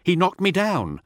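high, anxious report; nervous underling. Acted. Teth reporting his losses to Null, high and anxious. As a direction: rush out the bad news. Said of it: "says Teth".

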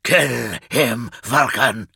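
harsh snarled command. A narrator's reading. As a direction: snarl it at your brother as an order. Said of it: "Kurz snarled".

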